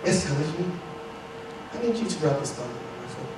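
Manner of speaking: soft, low request in a put-on British accent; hushed British movie Jesus; mock British accent. Jesus asking Peter for a foot rub, mock British accent. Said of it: "he has a British accent".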